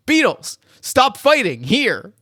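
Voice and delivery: high, loud, emphatic teacher's command; mock spiritual teacher. Spiritual teacher scolding the fighting Beatles, mock commanding. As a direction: imitate a guru breaking up a fight, hammed-up. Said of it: "the spiritual teacher ... says the great line".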